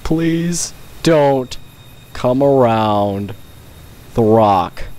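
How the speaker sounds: slow, drawn-out mock-solemn begging; slow plea. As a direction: stretch it out, dead serious, tongue in cheek. Same plea said again.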